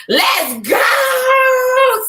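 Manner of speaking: high, loud celebratory cry; excited shout. Acted. Cheering herself on over a small win, excited shout. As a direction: hype it up like a cheerleader.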